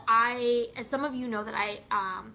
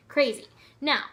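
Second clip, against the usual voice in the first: normal talking voice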